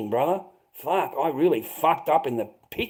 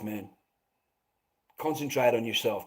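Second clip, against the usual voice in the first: normal talking voice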